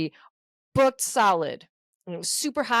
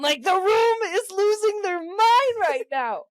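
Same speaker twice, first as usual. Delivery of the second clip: ordinary talk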